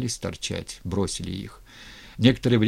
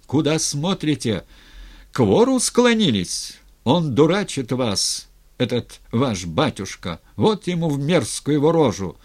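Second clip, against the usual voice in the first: higher, louder angry harangue; indignant shout; 5 semitones higher, 5 dB louder than usual. A narrator's reading. Governor haranguing archers who bowed to a thief, indignant shout. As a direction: rail at the crowd with contempt. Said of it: "крикнул он, обращаясь к стрельцам и горожанам".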